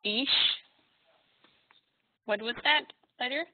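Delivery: ordinary talk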